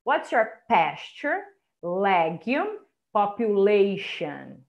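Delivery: slow model, PASTURE, LEGUME, POPULATION each set apart; teacher's slow drill, pausing between pasture, legume and population; stressed syllables marked. Shown as a demonstration. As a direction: stress PAS-ture, LEG-ume, popu-LA-tion. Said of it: "as estressadinhas tá sublinhado".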